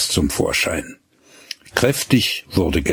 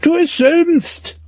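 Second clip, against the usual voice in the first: put-on high, louder girl's cheeky reply; cheeky retort; 4 dB louder than usual. A narrator's reading. Gretlieschen answers back while running off. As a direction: snap it back over the shoulder.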